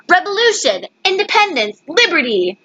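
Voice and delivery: high, rising cries of headlines; newspaper headline shout. A narrator's reading. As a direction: call each word out like a newsboy. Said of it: "Newspapers shouted".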